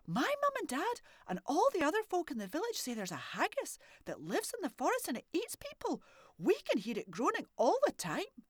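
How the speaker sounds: put-on high, bright child's voice; a curious little girl asking boldly; little girl Morag. Morag asking the old man if he's a haggis, high child voice.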